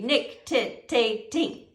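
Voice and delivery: "Nictitating" slowly broken into separate syllables; "Nictitating" in slow, separated beats for spelling. Shown as a demonstration. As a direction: sound out "Nictitating" one syllable at a time. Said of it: "Spell it out in, in syllables like that".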